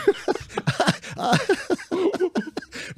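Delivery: long amused laugh. Real, not acted. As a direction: laugh at your own joke. Laughs after mock threat.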